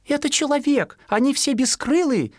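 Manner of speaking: high chirpy mother; mother sparrow. Acted. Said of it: "сказала воробьиха".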